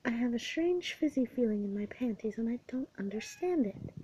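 ordinary delivery, nothing marked

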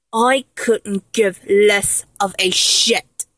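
usual speaking voice

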